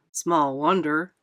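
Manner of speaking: low, slow fireman voice; fireman's dry remark. A narrator's reading. Fireman comments on the royal babysitter, dryly. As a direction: drop the pitch and draw it out. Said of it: "said a fireman".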